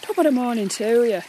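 stagey Irish greeting; hammed-up Irish accent. Shown as a demonstration. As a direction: put on a stock Irish brogue. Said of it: "Like, you got the Irish".

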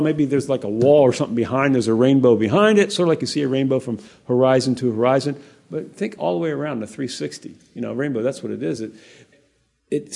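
ordinary talk, nothing marked

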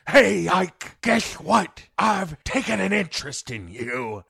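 loud, high hammed-up Joker menace; mock Joker. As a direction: play the villain's creepy friendliness. Joker voiced to Ike.

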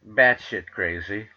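ordinary talk, nothing marked

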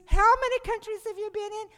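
a priest amazed at her story; curious priest. Retold or imitated. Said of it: "he goes".

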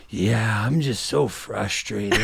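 slow, drawn-out complaining, lightly voiced; a hint of a weary, frustrated neighbor; mock frustrated neighbor. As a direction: drag it out like a tired neighbor. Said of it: "And he's like".

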